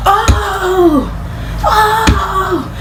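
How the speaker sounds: high, long-held startled cries. Shown as a demonstration. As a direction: yelp as the car swerves. Acts out swerving to avoid deer.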